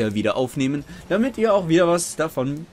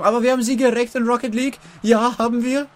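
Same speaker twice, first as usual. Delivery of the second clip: excited; 9 semitones higher, faster than usual. Real, not acted. Hyped about Rocket League win.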